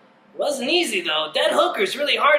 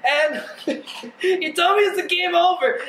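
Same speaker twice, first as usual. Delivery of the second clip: ordinary talk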